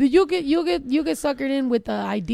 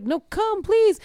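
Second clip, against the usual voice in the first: high-pitched mock plea, rising at the end; pleading; 9 semitones higher, much slower than usual. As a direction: beg them to come over, voice climbing. Mock begging the dancers to stay.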